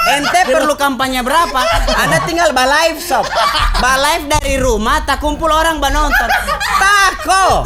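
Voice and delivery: high, loud, giggly teasing; laughing banter. Real, not acted. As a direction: joke around while laughing. Joking about live campaigning. (bercanda sambil tertawa)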